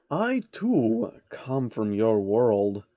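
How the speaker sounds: a hint of a scheming old man; slow and measured; lightly voiced sly old man. Soros voiced.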